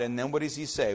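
usual speaking voice

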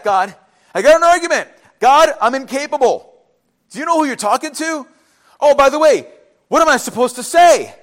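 loud, high-pitched objections in bursts; flustered protest. Acted. Moses arguing with God that he is incapable, flustered. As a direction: argue back urgently, voice pushed up.

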